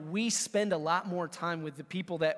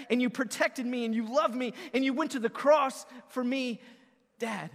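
higher, fervent pouring-out of thanks; impassioned prayer; 5 semitones higher, 4 dB louder than usual. Acted. Believer thanking the Heavenly Father, fervent raised pitch. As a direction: pray it out with building urgency. Acted heartfelt prayer to God.

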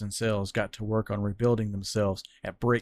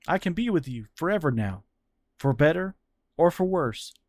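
put-on higher, heartfelt young man pleading; Fran's earnest voice; 6 semitones higher than usual. Fran confessing his love to Cyril, earnest. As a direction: pour your heart out to him.

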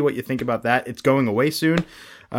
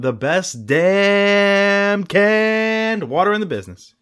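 high, held, drawn-out notes; slow, high announcer parody; hammed-up sponsor slogan; 7 semitones higher, much slower than usual. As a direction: stretch it out like an over-the-top jingle.

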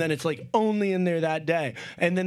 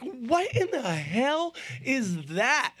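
slow, high, puzzled; stunned at the find; drawn-out high surprise; 7 semitones higher, slower than usual. Retold or imitated. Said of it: "I'm like".

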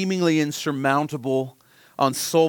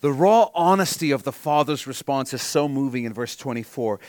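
ordinary talk

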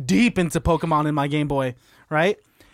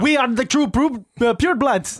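higher-pitched, clumsy mock German; hammed-up German accent, pitched up; bad German accent; 5 semitones higher than usual. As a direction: do a badly faked German accent. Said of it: "That's a bad German accent".

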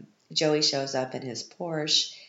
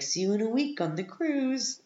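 high, put-on voice for the boyfriend's parting line; mocking boyfriend voice; 10 semitones higher than usual. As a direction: mimic him with a goofy lilt. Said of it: "And he's like".

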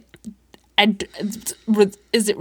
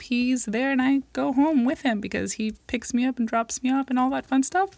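normal talking voice

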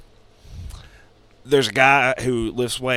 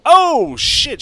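much higher, loud startled cry; shocked cry; 11 dB louder than usual. Retold or imitated. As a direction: yelp it like she just saw something awful. Palm reader shocked in retold story.

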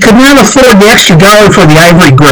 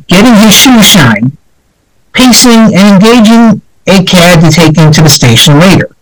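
usual speaking voice

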